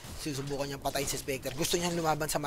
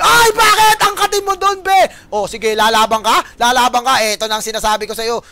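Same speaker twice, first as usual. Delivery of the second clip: high, loud outburst at a player's move; excited play-by-play; 7 semitones higher, 16 dB louder than usual. Real, not acted. Caster asking a player why they fight, loud shouting. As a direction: shout it in exasperated disbelief.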